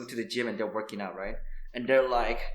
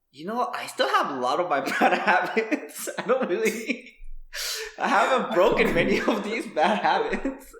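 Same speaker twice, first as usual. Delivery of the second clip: high-pitched, laughing self-talk; sheepish retold thought through laughter; laughing admission; 8 semitones higher, 5 dB louder than usual. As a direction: confess it while cracking up. Laughing confession of bad habits.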